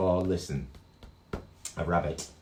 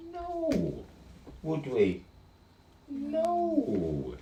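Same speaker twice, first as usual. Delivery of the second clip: high playful no; 11 semitones higher, much slower than usual. Acted. Call and answer with kids.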